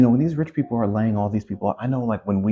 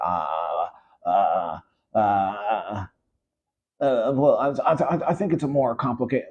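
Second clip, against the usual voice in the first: mock flustered stammer pitched much higher; hammed-up nervous tech exec, tripping over his words; stammering evasion; 3 semitones higher, slower than usual. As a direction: stutter and dodge the question like a cornered tech billionaire. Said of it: "what are we stuttering for?".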